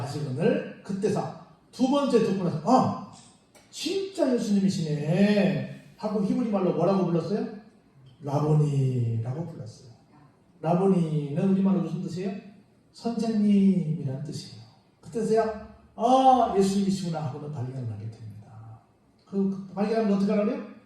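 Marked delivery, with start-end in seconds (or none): 2.6-5.8 drawn-out, slow realisation; acted amazed recognition. Mary recognising Jesus at last, acted amazement. 16.0-17.2 acted sudden realisation. Preacher voicing Mary discovering Jesus, acted surprise.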